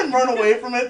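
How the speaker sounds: ordinary talk, nothing marked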